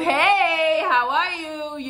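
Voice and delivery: slow drawn-out flirty greeting; dragging her words. Retold or imitated. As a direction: stretch each word out lazily. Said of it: "she's dragging her words".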